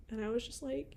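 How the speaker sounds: usual speaking voice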